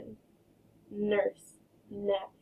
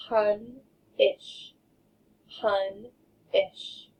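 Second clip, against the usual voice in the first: "Pun-ish" split in two beats; chopped into syllables; much slower than usual. Shown as a demonstration. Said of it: "get your choppers ready".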